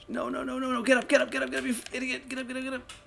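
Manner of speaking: frantic, high-pitched pleading; panicked. Real, not acted. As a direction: panic as you're caught. Caught by monster, frantic.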